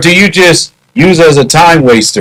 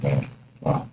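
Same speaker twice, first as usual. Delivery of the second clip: stunned hush; 11 semitones lower, 18 dB quieter than usual. Retold or imitated. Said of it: "I was like".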